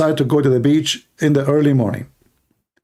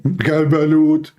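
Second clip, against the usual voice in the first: long drawn vendor shout; street vendor's call; 4 semitones higher than usual. Retold or imitated. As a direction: call out your wares on an empty beach. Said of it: "And then again".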